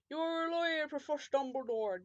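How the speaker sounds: put-on character voice, starting high; Hagrid. Hagrid answering the professor, broad put-on voice. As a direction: play it as a broad character impression. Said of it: "I finally got his fucking voice right".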